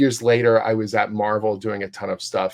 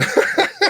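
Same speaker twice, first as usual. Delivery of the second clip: laugh; 6 dB louder than usual. Real, not acted. Laughing at own rambling.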